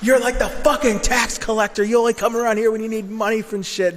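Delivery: high, full-force reenacted shouting; blowing up. Telling off a parent over money, angry blow-up. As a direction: lash out in a loud, furious accusation. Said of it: "like, I blew up. I go".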